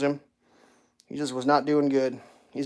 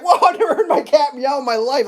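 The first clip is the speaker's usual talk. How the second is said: higher, louder amazed with a laugh; retold amazement; 10 semitones higher, 6 dB louder than usual. Man retelling his shock at hearing his cat meow, high and laughing. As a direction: gasp it out in disbelief. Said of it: "I was like".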